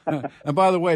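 loud, high, through leftover laughter; amused, laugh in the voice. Real, not acted. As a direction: say it while still chuckling. Still laughing after the joke.

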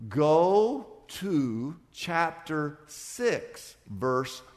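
very slow, every word spelled out; slow dictation for the margin note; dictating slowly. Shown as a demonstration. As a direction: spell it out as if they're writing it down. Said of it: "you write in the margin of your Bible".

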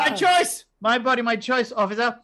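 high, loud mock-defiant protest; defiant retort. Imagined comeback to the mocking officer, loud and defiant. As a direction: fire it back like a hammed-up activist.

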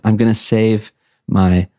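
usual speaking voice

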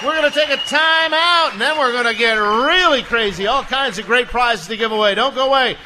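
loud, high game-show host shout; announcer voice. Acted. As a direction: boom it out to the crowd. Host announces to bar crowd.

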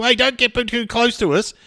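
mock yell. Man imitates golfers crowded from behind, high mock yell. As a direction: call out a hammed-up warning.

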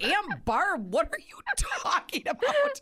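high, swooping mock-shocked outcry; scandalized disbelief. Retelling a shocked reply to a racy aunt, scandalized. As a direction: climb into incredulous squeal on the question.

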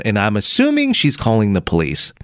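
ordinary delivery

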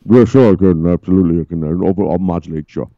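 low booming put-on voice; deep voice. Host to cohost, answering in a booming deep voice. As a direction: drop the voice way down and boom it. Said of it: "his voice is so deep".

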